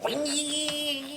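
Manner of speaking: high, thin mock engine whine, wee; imitated weed eater whine. Dad imitating his weed eater to the audience, high mock whine. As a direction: make the weed eater sound with your voice. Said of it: "and I go".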